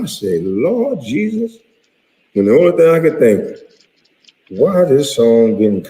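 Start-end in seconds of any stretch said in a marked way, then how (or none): 0.4-1.6 amazed. Retold or imitated. Overwhelmed reaction in the club.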